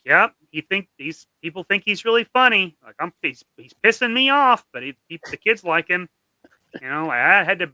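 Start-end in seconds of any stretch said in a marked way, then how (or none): 0.1-6.1 a touch of the annoyed teacher, louder, voice climbing higher; exasperated teacher. Retold or imitated. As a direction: play the fed-up teacher complaining at a parent-teacher conference. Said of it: "Parent-teacher conferences where they're like".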